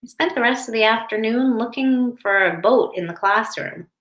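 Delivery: put-on lively storybook narration for kids; reading to children. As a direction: read it aloud like to a classroom. Reads picture book to class.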